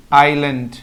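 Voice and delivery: आइलैंड said as "ai-land", silent s; teacher models आइलैंड with no s sound; correct pronunciation. Shown as a demonstration. Teacher shows pupils the right आइलैंड. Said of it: "इसलैंड नहीं बोलेंगे बच्चों".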